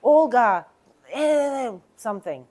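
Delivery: loud sharp call of a name, then trailing off; mock upset client. Client snapping at the agent, a loud mock complaint. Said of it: "If someone is".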